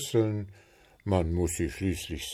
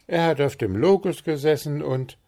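higher-pitched, frank little bird; very candid; 7 semitones higher, 4 dB louder than usual. A narrator's reading. Little bird replying about Puh, very candid. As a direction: answer frankly in a high bird voice. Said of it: "erwiderte der kleine Vogel äußerst freimütig".

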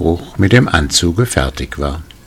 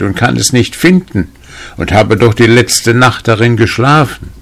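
raised, pleading storybook farmer; 5 semitones higher, 4 dB louder than usual. A narrator's reading. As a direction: appeal for help in a higher, louder voice. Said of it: "bat der Bauer".

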